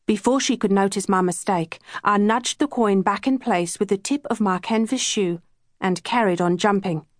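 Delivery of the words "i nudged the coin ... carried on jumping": ordinary delivery, nothing marked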